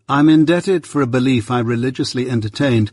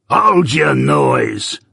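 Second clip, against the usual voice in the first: slow, rough convict bark, higher and drawn out; a menacing escaped convict snapping at a child; terrible voice; 4 semitones higher, 3 dB louder, much slower than usual. Acted. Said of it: "cried a terrible voice".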